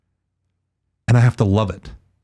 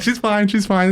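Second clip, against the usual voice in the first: higher, offhand brush-off; dismissive reassurance; 8 semitones higher than usual. Retold or imitated. Host retelling how he brushed off the grandmother. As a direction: wave it away casually. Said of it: "And it's like".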